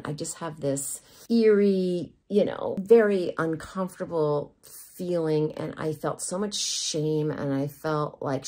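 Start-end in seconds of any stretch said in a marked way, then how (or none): none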